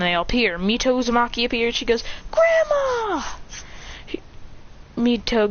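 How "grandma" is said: very high, drawn-out squeal; long high-pitched greeting; delighted cry. Acted. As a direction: call out in thrilled surprise. Delighted at grandmother appearing.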